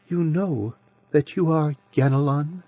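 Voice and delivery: put-on soft childish voice of an ancient being; sweet childish voice. A narrator's reading. Adiran's voice held.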